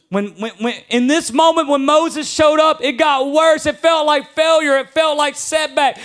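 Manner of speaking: climbing sermon intensity; preaching build. Acted. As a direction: drive it home to the congregation. Preaching climax.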